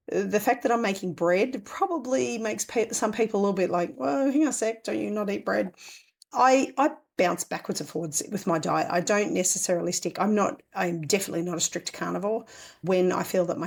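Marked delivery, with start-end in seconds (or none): none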